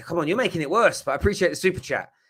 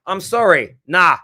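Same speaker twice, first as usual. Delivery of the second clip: sarcastic; 4 semitones higher, 9 dB louder, slower than usual. Real, not acted. Mock apology then refusal.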